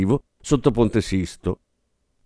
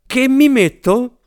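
much higher, slow panicked cry; shriek; 11 semitones higher, 5 dB louder, slower than usual. Acted. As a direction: wail it in a panic. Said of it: "urlò".

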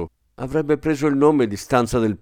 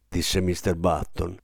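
ordinary delivery

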